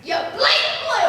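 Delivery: loud, high cry of outrage; angry outburst. Acted. Accomplice turning on Pierre after the failure, angry outburst. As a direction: blow up at your accomplice. Accomplices quarrel over failed plan.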